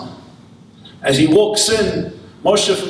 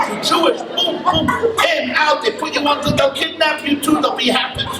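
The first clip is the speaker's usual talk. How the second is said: higher, lively, proud of the straps; excited boasting; 8 semitones higher than usual. Acted. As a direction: sell him the airport's wonders with glee. Israeli boasts about airport Chabad.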